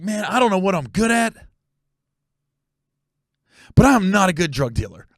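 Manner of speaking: imitating an earnest confession, long pause before "but". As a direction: leave "good at" hanging, then deliver "but I'm not" after a long silence. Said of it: "it was the pause".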